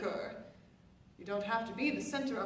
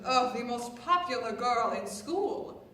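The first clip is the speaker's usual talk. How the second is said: ordinary talk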